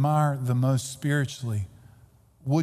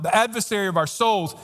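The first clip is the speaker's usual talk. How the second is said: ordinary talk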